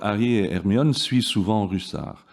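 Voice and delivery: Harry et Hermione suivent souvent Russard in French; French sentence read with French vowels; French pronunciation. Shown as a demonstration. Professor reads French example to class. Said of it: "the French counterpart".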